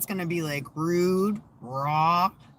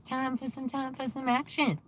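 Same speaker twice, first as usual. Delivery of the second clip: high, sing-song taunting spirit; teasing jinn voice; 9 semitones higher than usual. Pretend. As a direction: tease like a mischievous spirit. Voicing a spirit taking over.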